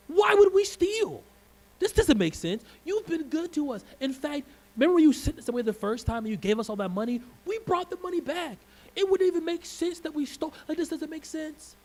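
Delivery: high, loud, indignant pleading voice; pleading brothers. Retold or imitated. Brothers pleading with Joseph that they are innocent, high indignant plea. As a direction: beg him to see reason, voice up high.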